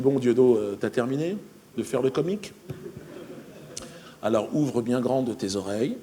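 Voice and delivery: a hint of a solemn friend. Friend announcing his abduction, low and grave. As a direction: lay it out like a sacred truth.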